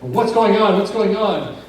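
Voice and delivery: a guard with his hand on his pistol, demanding answers; alarmed demand. Retold or imitated. Bodyguard demanding to know what happened, loud and tense.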